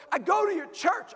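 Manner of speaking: high imitated fan voice; mock-eager young lady; excited young woman. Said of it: "She goes".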